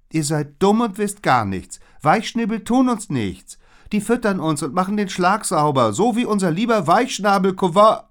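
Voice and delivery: high, loud nasal know-it-all. A narrator's reading. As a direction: scoff through the nose, high and loud. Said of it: "Salome sah sich zu Liz um und näselte". (hoch und laut genäselt)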